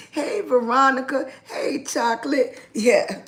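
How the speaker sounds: ordinary talk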